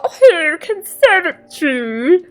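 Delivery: drawn-out posh English imitation; posh English accent. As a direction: stretch it like a plummy English toff. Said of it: "posher English".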